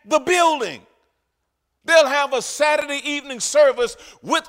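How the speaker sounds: loud, rising sermon delivery to the congregation; full-force preaching. Acted. Preacher driving home the point to his church, loudly. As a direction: preach it hard and high over the room.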